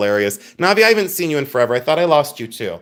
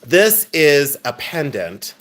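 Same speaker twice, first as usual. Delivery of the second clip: normal talking voice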